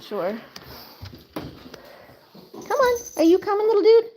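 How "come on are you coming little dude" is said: very high sing-song calling the puppy; high pet call. Real, not acted. Calling the dog to follow.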